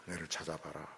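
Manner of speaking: very soft, almost whispered tease; near-whispered teasing. Retold or imitated. God hiding behind the door, near-whispering a playful dare. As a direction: fade it to a hush. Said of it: "왜 그렇게 하십니까?".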